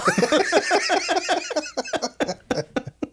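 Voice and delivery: long, high delighted laughter; amused laughter. Real, not acted. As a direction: crack up at the line. Laughs after the line.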